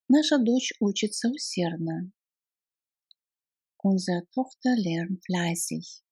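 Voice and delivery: ordinary talk, nothing marked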